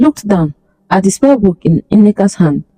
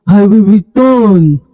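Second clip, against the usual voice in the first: slow, drawn-out spirit threat; fading echo; slower than usual. Acted. Spirit fades, repeated threat.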